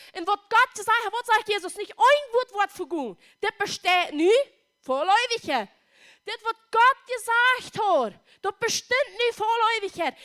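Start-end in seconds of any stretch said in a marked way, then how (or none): none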